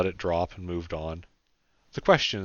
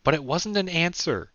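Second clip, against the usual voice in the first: challenging; 10 semitones higher, 4 dB louder than usual. A narrator's reading. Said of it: "she challenged".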